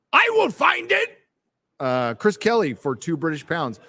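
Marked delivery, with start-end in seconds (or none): 0.1-1.2 much higher, sing-song I will find it; high silly voice. Acted. Streamer promising viewer to find chat, high silly voice. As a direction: squeak the promise playfully.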